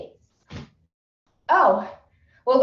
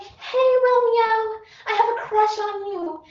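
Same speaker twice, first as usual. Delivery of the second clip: put-on crushing girl voice; 10 semitones higher than usual. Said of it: "Juliet's like".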